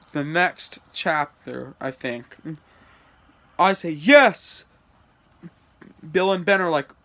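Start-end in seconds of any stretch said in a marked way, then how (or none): 3.9-4.6 loud, high yes; short loud cheer. Acted. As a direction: punch out a happy yes. Acting own cheer in story.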